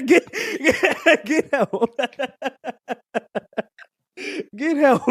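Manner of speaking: breaking up with laughter mid-word; can barely get the words out for laughing; helpless giggling repeat. Real, not acted. Cracking up at a lyric.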